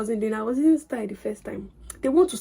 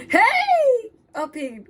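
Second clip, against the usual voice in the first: calling out; 11 semitones higher, 4 dB louder, much slower than usual. Pretend. Calling out to a boy.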